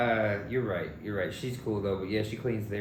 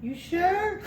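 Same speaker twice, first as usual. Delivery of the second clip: high mock lady voice; 5 dB louder than usual. Retelling Rosie insisting.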